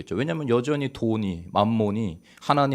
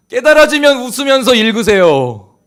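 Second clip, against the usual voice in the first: very loud, high-pitched pulpit shout; impassioned shouted exhortation; full-force preaching; 13 dB louder than usual. Real, not acted. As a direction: cry it out to rouse the congregation.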